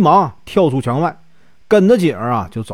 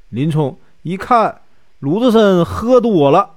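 plain reading voice

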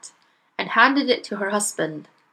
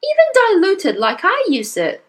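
high, matter-of-fact scolding; mother in the story; 11 semitones higher, 4 dB louder than usual. Acted. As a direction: tell him in a high voice. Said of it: "the mother told him".

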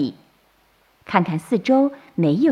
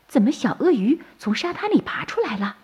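higher-pitched wondering question; puzzled surprise; 6 semitones higher, 3 dB quieter than usual. A narrator's reading. Turtle mother sees baby crocodiles hatch, puzzled surprise. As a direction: ask it in mild, puzzled amazement.

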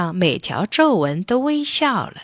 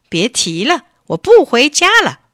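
put-on angry boy's outburst, climbing higher and louder; huffy; 7 semitones higher, 8 dB louder than usual. A narrator's reading. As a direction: huff it out like an indignant child. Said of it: "巴夫利克气呼呼地说". (气呼呼地嚷)

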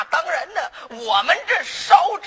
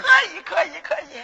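tag; 4 semitones higher than usual. Acted. 掌柜殷勤招揽财主.

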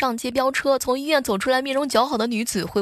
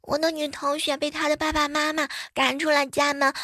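put-on high child's voice; earnest little kid; 5 semitones higher than usual.

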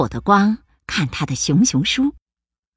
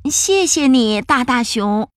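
little bear voice; 7 semitones higher than usual. Acted. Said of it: "little bear thanks".

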